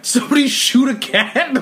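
amused retold bafflement; giggling mock question; laughing disbelief. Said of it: "I'm like".

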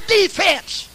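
high cheering-section yell; high-pitched sideline cheer; crowd holler. Acted. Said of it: "Everybody hollered".

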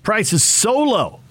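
high, slow promo announcer; hard sell ad. Acted. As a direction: push the deal like a radio ad. Station promo and ad read.

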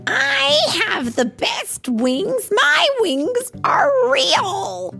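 very high, drawn-out storybook wail. A narrator's reading. Goosey protesting the lost contest, wailing. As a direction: wail it slowly like a sore loser. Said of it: "Goosey wailed".

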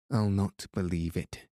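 plain reading voice

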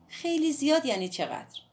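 put-on a defiant girl explaining herself; Midori's voice. A narrator's reading. Midori asks how much.

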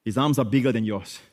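ordinary talk, nothing marked

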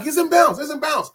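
high, loud re-enacted fan yell; excited fan's shout, acted back; retold screaming. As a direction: yell it like you did at the TV. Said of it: "I start screaming".

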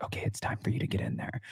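whispered producer cue. Retold or imitated. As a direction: hush it like a producer off camera. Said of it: "production was like".

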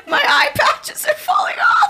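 laughing through tears; high, weepy and giggly; snotty crying. Real, not acted. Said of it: "we're hysterical over here".

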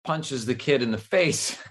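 ordinary delivery

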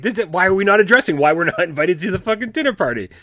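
high, loud mock protest; mock outrage. Co-host objects to missing the dinner party, mock outraged. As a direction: burst in high and loud, playfully offended.

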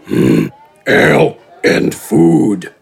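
deep, slow gravelly giant grumble; low grumbly voice. Acted. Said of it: "in the low, grumbly voice one would expect".

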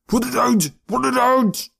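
high, panicked scream; frantic scream. A narrator's reading. Pinky pats at flames on his head, frantic scream. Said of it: "He screamed as he patted frantically".

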